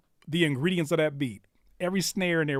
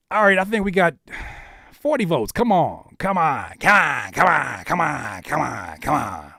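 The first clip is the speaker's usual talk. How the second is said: loud repeated urging, each falling sharply; rally them loudly, then tumble down in pitch; urgent egging-on; 6 dB louder than usual. Real, not acted. Host urging chat to reach forty votes, insistent.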